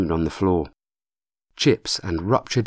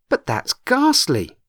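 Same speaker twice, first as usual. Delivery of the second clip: slow, appalled and higher; shocked; 9 semitones higher, slower than usual. A narrator's reading. Chrissy hearing of the death, slow appalled shock. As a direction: recoil at the horror.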